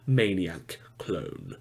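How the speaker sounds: sudden low whisper; whispered aside. Acted. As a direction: drop to a low whisper on the words. Ominous aside in news read.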